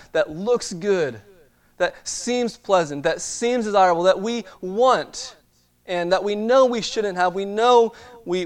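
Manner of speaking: preaching. Acted. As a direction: drive the point home from the pulpit. Preaches the lesson of temptation.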